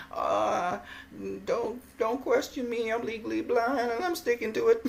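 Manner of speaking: high-pitched, hammed-up parody voice; mock Adelaide Byrd. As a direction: put on a silly high voice, hesitant then insistent. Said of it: "Ain't the one that would say".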